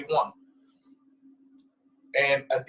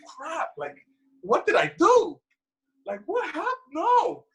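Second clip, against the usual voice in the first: mock panic; 13 semitones higher than usual. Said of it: "You're like".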